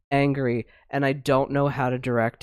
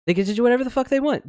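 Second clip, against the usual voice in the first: higher, fast indignant repeat; exasperated outburst; 9 semitones higher, much faster than usual. Real, not acted. Host fuming about the powerful doing anything, fast higher outburst. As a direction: spit it out again, fed up. Repeats the line, second time punched.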